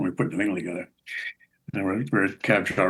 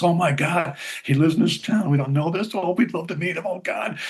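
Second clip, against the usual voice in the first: higher, breathless gushing fan; excited gushing; 9 semitones higher than usual. Retold or imitated. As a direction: gush like a starstruck manager. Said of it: "the manager comes flying out".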